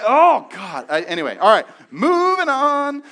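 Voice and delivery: loud, high frustrated sigh of words; the pastor cutting off his own anger; exasperated. Real, not acted. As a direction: force yourself to move on. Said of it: "I am already getting angry".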